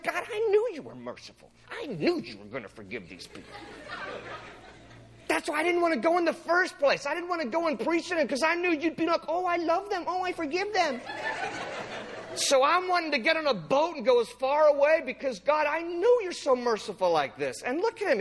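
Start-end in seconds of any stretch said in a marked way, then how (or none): 0.0-3.5 hammed-up petulant complaint; Jonah with attitude. Jonah complaining to God about mercy, petulant. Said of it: "He's just got this attitude". 5.3-17.1 high-pitched mock teen whine; hammed-up bratty protest in a raised voice; Jonah as middle school girl. Jonah whining to God about forgiving them, petulant. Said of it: "He's like this middle school girl".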